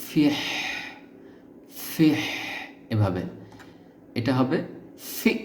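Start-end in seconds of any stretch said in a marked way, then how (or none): 0.0-2.2 ফিহ ending in a breathy h from the throat, tongue touching nothing; throaty h demo. Shown as a demonstration. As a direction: push air from the throat on ফিহ. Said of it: "শুধু গলা দিয়ে একটা বাতাস বের হবে". (গলা দিয়ে শুধু বাতাস বের করে ফিহ)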